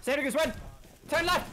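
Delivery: high, loud urgent callouts; urgent shouting. Real, not acted. Player warning Sanders to run, urgent high shout. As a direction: yell orders at a teammate in danger.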